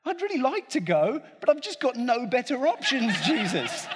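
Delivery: high, laughing grudging admission; half-laughing, no better options; playful mock Peter, giggling; mock reluctant, laughing. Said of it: "He's saying".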